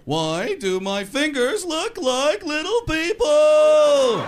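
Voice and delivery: high, drawn-out comic freak-out; panicked sitcom voice. Acted. Seeing little people on his fingers, high comic panic.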